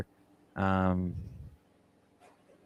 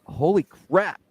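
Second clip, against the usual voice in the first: mock amazement; 8 semitones higher, much slower than usual. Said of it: "I was like".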